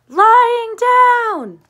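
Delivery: very high, very loud yell. A narrator's reading. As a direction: scream it at the top of your lungs. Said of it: "yelled and yelled".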